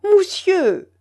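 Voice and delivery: high, loud cry of Moussieu!; sharp call to the croupier. A narrator's reading. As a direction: call out to get his attention. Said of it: "cria-t-elle".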